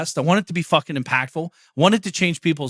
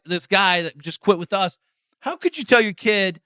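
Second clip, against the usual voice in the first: normal talking voice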